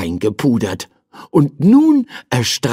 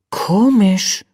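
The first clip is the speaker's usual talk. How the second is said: put-on higher-pitched child's voice, puzzled; puzzled little boy; 10 semitones higher than usual. A narrator's reading. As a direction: voice a small boy puzzling to himself. Boy's puzzled thought, voiced in character.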